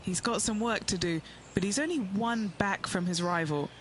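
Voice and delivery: ordinary talk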